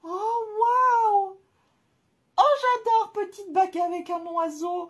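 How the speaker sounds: high, loud burst of delight; real, thrilled outburst, high and loud; excited squeal. Real, not acted. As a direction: squeal over a treasure. Unboxing a bird ring.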